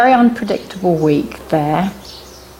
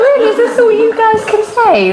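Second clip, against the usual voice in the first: mock indignation; 3 dB louder than usual. Acted comeback to teasing students.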